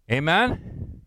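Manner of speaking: ordinary talk, nothing marked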